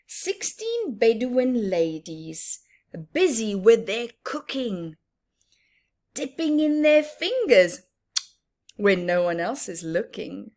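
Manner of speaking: sing-song picture book reading; reading a rhyme aloud. Acted. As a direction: savour each line for the children. Reading rhyming verse to children.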